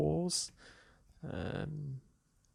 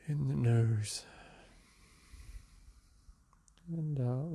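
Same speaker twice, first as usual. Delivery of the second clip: calm, softer meditation guide voice; guided meditation; 3 dB quieter, slower than usual. Acted. Teacher guiding listeners through a seated meditation, calm and soft. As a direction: ease the listener into the breath.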